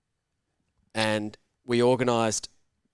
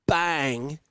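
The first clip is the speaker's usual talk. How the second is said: usual speaking voice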